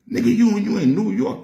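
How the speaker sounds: high, loud disbelief; can't believe what he's hearing; shocked outburst at his little brother; incredulous. Retold or imitated. Incredulous at brother's city claim.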